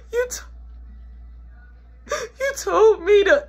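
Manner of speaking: high, tearful stammer with gasps; mock crying. Kid answering mama, hammed-up tearful stammer. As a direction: sob out a broken excuse. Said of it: "I don't wanna hear the waterworks".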